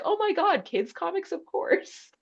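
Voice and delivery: high gushing delight; excited shopkeeper. Retold or imitated. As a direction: squeal with delight at the idea. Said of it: "they'll be like".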